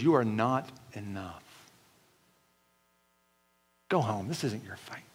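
very low, hushed voice fading to a whisper; giant of criticism. Acted. The giant of criticism belittles the listener, low and hushed. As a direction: sink low and soft, ending in a whisper. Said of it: "The giant of criticism says".

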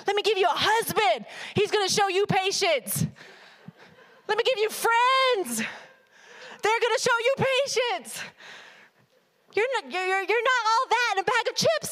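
God gently ribbing Sasha, rising to a mock shout. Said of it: "he's got".

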